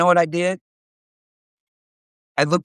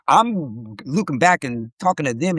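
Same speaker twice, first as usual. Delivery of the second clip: ordinary delivery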